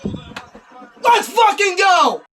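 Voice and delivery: screaming with joy, high and loud; ecstatic shout. Real, not acted. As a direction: yell out the win. Celebrating the winning stop.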